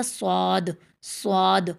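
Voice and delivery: صاد said as "swaad", with a w glide; wrong rounded Saad. Shown as a demonstration. Teacher shows the habitual mistake, صاد with a w sound. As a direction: round the lips into a w inside صاد. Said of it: "واو کی آواز آتی، ہونٹ گول ہوتے".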